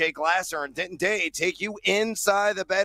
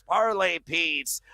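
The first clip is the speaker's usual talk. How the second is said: normal talking voice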